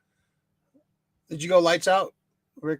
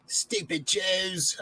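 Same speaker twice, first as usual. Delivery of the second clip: very slow, softer, drawn-out villain sneer; Zartan impression; 8 dB quieter, much slower than usual. Host joins in mimicking the villain, slow and soft. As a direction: stretch it out like a sly cartoon baddie. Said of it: "His accent was kinda all over the place".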